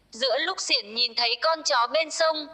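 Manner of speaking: usual speaking voice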